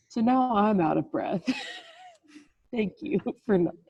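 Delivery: laughing through a retold grumble; laughing mock complaint. Trainer retells replying to the kid who was fine, laughing. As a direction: break into laughter while mock-thanking.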